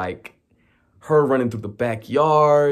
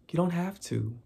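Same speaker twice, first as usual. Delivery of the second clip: higher, softer, sweet innocent voice; 6 semitones higher, 7 dB quieter than usual. Retold or imitated. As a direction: sound harmless and sweet. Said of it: "looks all innocent, sweet".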